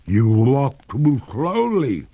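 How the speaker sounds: a freezing ghost lady begging him to hurry; ghost in distress. A narrator's reading. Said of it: "she said".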